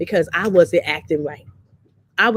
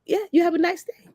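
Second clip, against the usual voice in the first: surprised huh; 10 semitones higher than usual. Retold or imitated. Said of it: "He was like".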